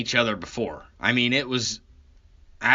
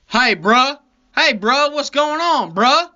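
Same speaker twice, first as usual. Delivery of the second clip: much higher, louder mock "bruh" voice; switched-up street talk; 13 semitones higher, 7 dB louder than usual. Cop greeting the driver at his door, loud high imitated street talk. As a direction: call out like a hammed-up street buddy. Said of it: "that's not how you were talking".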